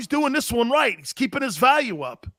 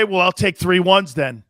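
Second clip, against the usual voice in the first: ordinary talk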